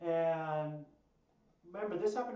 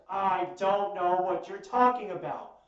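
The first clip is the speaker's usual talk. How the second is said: loud, slow, emphatic retold denial; firmly voiced mock innocence; straight-faced denial; 3 semitones higher, 12 dB louder than usual. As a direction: imitate a stubborn denial, word by word. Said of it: "with a straight face".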